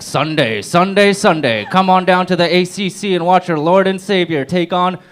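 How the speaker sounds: loud booming announcer hype; monster truck ad. Acted. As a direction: yell it like a truck rally ad. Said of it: "like one of those monster truck commercials".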